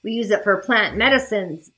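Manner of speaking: ordinary delivery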